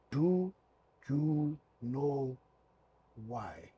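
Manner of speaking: word by word, long pauses between; halting reading aloud. Retold or imitated. Ref slowly reads English shirt.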